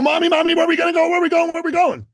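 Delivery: put-on high, insistent little boy's voice; voicing a hyper boy pestering his mom; hyper kid. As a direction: whine it like an impatient twelve-year-old. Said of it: "my little son, being twelve and hyper".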